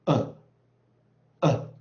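forceful short bursts of 呃; heavier stress. Shown as a demonstration. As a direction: hit each 呃 hard. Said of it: "重一点".

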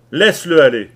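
loud barked order; commanding. Acted. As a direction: order him to let go. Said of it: "ordonna Leach".

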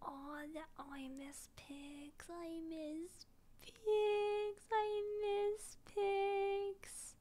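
slow, high, whimpering wail; tearful longing. Real, not acted. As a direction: stretch it out, close to tears. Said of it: "I'm gonna cry 'cause I miss pigs".

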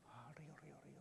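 soft unvoiced whisper, trailing off; whispered echo. Speaker whispering a playful echo of his river image, hushed. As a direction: breathe the words like a murmur to yourself.

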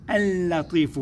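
اللطيف slowly, its L held long; doubled lam. Shown as a demonstration. Teacher showing which letter is heard, doubled lam. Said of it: "اللام التي ننطقها".

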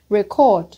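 Record stressed on CORD; light first syllable in "Record", lean on the second. Shown as a demonstration. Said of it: "record phải rơi vào âm tiết hai".

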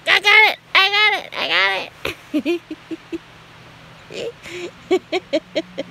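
high, loud playful cry of victory; triumphant shout. Acted. Owner play-fighting her cat, triumphant victory shout. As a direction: crow like you won the fight.

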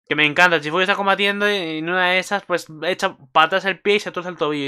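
getting carried away by the fight; breathless excitement; excited. Real, not acted.

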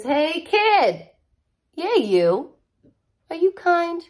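lively, bright storybook read-aloud; reading to children. Acted. Teacher reads the blurb to the class, upbeat storybook voice. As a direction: sell the book to a kid with big energy.

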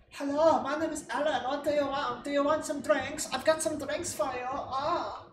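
high, put-on European maid voice, eager to serve; mock European maid accent. Vlogger voicing her maid character offering drinks, high mock European accent. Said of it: "Maybe she's, like, Polish or Dutch".

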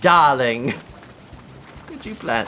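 posh "Darling" with a long first vowel; lightly posh "Darling.". Shown as a demonstration. Friend contrasting with the posh darling, lightly. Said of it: "Not, not".

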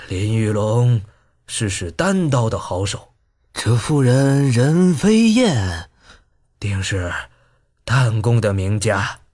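low, slow and pondering; slow musing. A narrator's reading. Old Xiaoyaozi answering his brother, slow low musing. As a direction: weigh each word slowly. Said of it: "逍遥子沉吟道".

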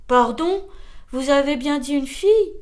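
loud, startled question; crying out. A narrator's reading. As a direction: cry out the question in surprise. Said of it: "s'écria l'escargot".

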